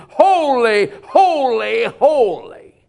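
loud, high holy; preacher voicing the seraphs' cry; seraphs crying. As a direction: ring it out like the seraphs. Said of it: "these seraphims crying".

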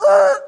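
high, loud jolted grunt; a sharp noise like hitting the brakes; startled grunt. Retold or imitated. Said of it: "And I went".

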